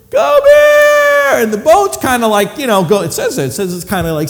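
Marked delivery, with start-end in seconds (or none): 0.1-1.4 high shouted call across water. Acted. Peter shouts to John on the shore, calling loudly. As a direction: hail John from the boat.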